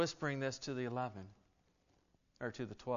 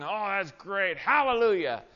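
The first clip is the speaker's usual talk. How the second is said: a sudden mock shout of praise, higher and louder; 7 semitones higher, 10 dB louder than usual. Pastor mocking the fair-weather believer's praise, hammed-up and loud. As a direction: ham up a fleeting burst of joy after a nice song.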